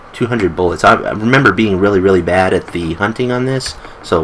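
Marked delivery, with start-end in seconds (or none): none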